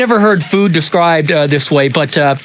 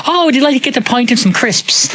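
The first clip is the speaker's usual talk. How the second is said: higher, lightly Irish voice; a touch of an Irish cousin offering crisps; 8 semitones higher than usual. Retold or imitated. Cousin in Irish pub, light Irish accent. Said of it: "a pub in Ireland, he's like".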